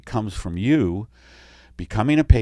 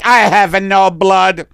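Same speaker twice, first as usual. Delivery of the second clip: slow, higher, louder Italian; Italian alien accent; 8 semitones higher, 8 dB louder, slower than usual. Pretend. Italian alien telling the doctor his first name, Italian accent. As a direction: introduce yourself grandly in Italian. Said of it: "you need to get a better Italian accent".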